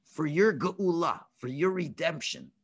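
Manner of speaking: low, slow, measured pronouncement; God speaking. Acted. God reassuring the slaves each matters, slow and low. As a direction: lay it out slowly and low, with weight. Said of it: "So Hashem says".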